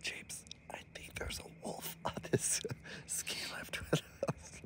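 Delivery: breathy spooky whisper with a giggle. Real, not acted. To James on the lift, a teasing spooky whisper. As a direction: whisper it like a secret.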